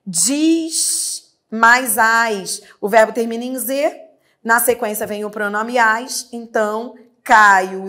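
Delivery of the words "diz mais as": "Diz mais as" dragged out; very slow demo. Shown as a demonstration. Teacher reads the next exercise item, very slow. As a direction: spell out "Diz mais as" very slowly.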